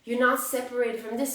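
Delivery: ordinary delivery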